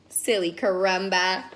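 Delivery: ordinary delivery, nothing marked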